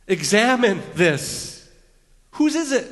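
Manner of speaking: loud demand climbing very high; emphatic investigation. Acted. Judah presenting the coat to his dad, loudly demanding. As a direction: demand it, voice leaping up on the question. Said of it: "it's kinda like emphatic investigation".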